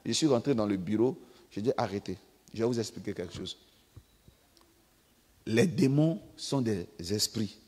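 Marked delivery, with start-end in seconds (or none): 1.8-3.6 calm interruption. Retold or imitated. He interrupts the prayer.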